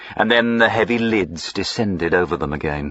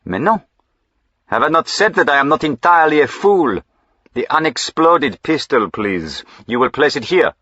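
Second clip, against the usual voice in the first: impatient; 8 semitones higher, 4 dB louder than usual. A narrator's reading. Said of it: "shook his head impatiently".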